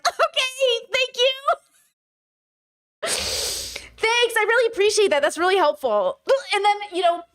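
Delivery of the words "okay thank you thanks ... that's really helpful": much higher, sugary fake gratitude; high fake-polite thanks. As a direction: chirp thanks while seething. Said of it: "And I'm like".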